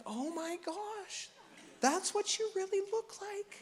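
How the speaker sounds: a stunned spouse muttering to themselves; shocked hushed aside. Retold or imitated. Preacher voicing a spouse seeing the partner unmade-up, hushed shock. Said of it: "you're like".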